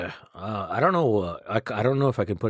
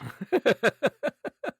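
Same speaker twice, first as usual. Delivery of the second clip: high squeaky laugh. Real, not acted.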